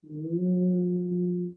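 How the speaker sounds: steady humming bee breath; bumblebee humming. Shown as a demonstration. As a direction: hum out like a bumblebee. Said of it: "bumblebee breath".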